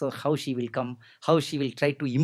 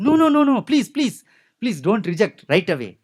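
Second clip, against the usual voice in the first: begging; 7 semitones higher, 5 dB louder than usual. Acted. She begs Rama.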